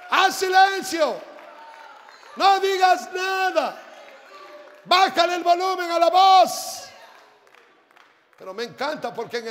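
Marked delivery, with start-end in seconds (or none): 0.1-6.9 loud, high scolding after a long shush; shouted rebuke. Acted. Crowd rebuking the man crying out, shouted scolding. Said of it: "Y les reprendía".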